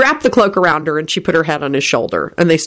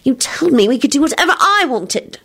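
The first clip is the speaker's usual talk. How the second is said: high, grumpy whine; petulant high-pitched reproach; 10 semitones higher than usual. A narrator's reading. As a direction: protest sulkily in a high voice. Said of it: "Solange said grumpily".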